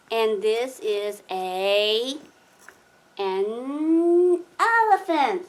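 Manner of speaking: slow, drawn-out "an elephant" rising at the end; slow sing-song naming; slow prompt. Shown as a demonstration. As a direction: coax the toddler with a stretched-out word. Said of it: "teaching child animal names".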